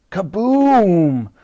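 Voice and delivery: long, drawn-out kaboom; booming explosion. A narrator's reading. Another barrel explodes, booming. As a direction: boom it out like a blast. Narrator imitates repeated explosions.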